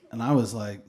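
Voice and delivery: normal talking voice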